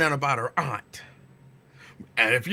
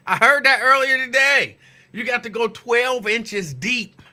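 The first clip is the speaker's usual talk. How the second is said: teasing; 6 semitones higher, 6 dB louder than usual. Real, not acted. Teasing a caller's advice.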